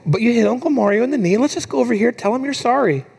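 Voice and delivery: acted parent nudging a small child along; coaxing dad. Dad urges his ashamed daughter to apologize, coaxing. Dad voiced prompting toddler.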